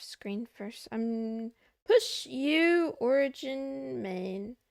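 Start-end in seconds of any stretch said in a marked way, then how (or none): 1.9-4.6 spelling out slowly. Real, not acted. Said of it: "types command slowly".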